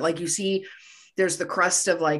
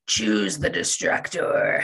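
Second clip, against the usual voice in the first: ominous voice; 10 semitones lower, slower than usual. Retold or imitated. Gozer's disembodied voice.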